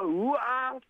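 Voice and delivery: high-pitched "uwaa" of a shocked crowd; imitated crowd cry. Commentator recreating the audience reaction, mock cry of shock. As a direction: mimic spectators crying out, pitched high without yelling. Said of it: "ていう声が上がりましてですね".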